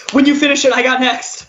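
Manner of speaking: hammed-up mock dibs; mock pushy guy. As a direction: call dibs on the burger. Imitating the guy at In-N-Out.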